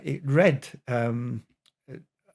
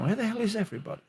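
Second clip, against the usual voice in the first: mock angry shout; 11 semitones higher, 3 dB quieter, much faster than usual. Father finds no wife or kids at home, mock angry shout. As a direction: mildly imitate an angry father calling out. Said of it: "he shouts in an angry tone".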